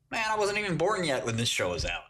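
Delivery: high-pitched defensive comeback; mock protest. Excusing himself to his friend over the reference, mock protest. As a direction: protest it high, easing down toward the end. Retells own defensive reply.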